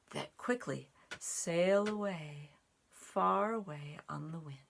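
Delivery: put-on slow, gentle read-aloud for children; story narration. A narrator's reading. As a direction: draw the little ones into the tale, unhurried. Reads a picture story aloud.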